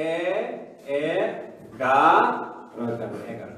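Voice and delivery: এগারো broken into এ, গা, র, then joined; syllable by syllable. Shown as a demonstration. Teacher breaks the word apart for students, syllable by syllable. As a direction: spell out the syllables, then put them together. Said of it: "এগারো কি হবে".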